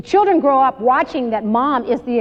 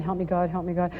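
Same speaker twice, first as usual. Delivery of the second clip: muttered plea; 5 semitones lower, 8 dB quieter, faster than usual. Retold or imitated. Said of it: "all the time I'm yelling".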